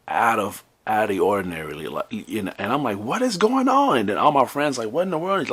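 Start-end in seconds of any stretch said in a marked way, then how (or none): 3.0-4.0 high, slow, louder disbelief; baffled exclamation. Retold or imitated. Retold shock at her screaming call. 4.9-5.5 high-pitched imitation of stunned friends; shocked reaction.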